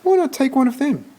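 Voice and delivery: put-on lady arguing with the boy, high and incredulous; incredulous shelter lady. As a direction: talk him out of it, baffled. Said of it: "said the woman".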